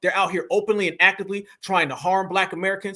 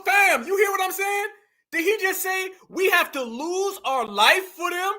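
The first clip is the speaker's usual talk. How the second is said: outraged shout; 8 semitones higher than usual. Real, not acted. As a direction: shout it at the screen in disbelief. Outraged at the clip's claim.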